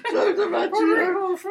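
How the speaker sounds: the granny listing names while cracking up; old lady laughing. Retold or imitated. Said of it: "she said".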